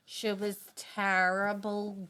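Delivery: slow drawn-out granny voice; granny drawl. Retold or imitated. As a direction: draw it out like a granny with a pause. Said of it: "because she would go".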